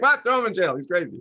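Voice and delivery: mock outrage. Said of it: "And he's like".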